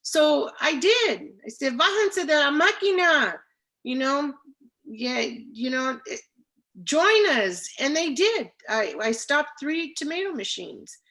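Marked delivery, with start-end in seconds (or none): 1.8-3.4 loud, high call across the field; bullhorn call. Retold or imitated. Girl on the bullhorn calling pickers off the machines, loud. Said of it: "well, here's the bullhorn". 6.8-7.7 loud, high call across the field; bullhorn call. Retold or imitated. Girl on the bullhorn calling pickers off the machines, loud. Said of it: "well, here's the bullhorn".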